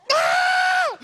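very high, loud mock scream; long hammed-up shriek; exaggerated scream. Husband acting out the shower moment, exaggerated scream. Imitated cry of pain or alarm.

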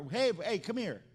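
loud high call to come close; calling over. Retold or imitated. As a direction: wave him over with your voice.

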